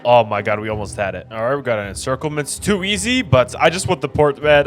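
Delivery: usual speaking voice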